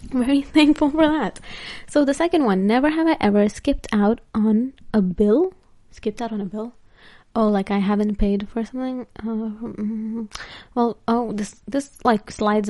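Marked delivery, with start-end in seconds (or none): 5.9-6.7 much softer, questioning to oneself; puzzled repeat. Real, not acted. Vlogger puzzles over the game question, said softly to herself. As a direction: repeat it quietly as if working out the meaning.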